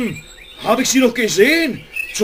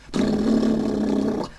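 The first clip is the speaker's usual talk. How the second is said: imitated jackhammer brrr; 4 dB quieter than usual.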